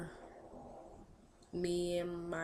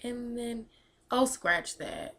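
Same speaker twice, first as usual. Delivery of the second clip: normal talking voice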